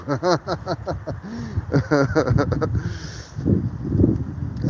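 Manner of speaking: long happy laugh. Real, not acted. Laughing at own Viking look.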